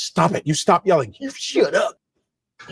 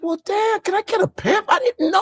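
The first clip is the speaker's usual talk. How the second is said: very high, squeaky protest to Dad; pleading son. Retold or imitated. Son excusing himself to his father, pleading. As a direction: plead your case way up high. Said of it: "I was like".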